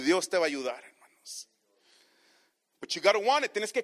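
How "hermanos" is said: a breathy aside, hermanos almost unvoiced; whispered hermanos. Real, not acted.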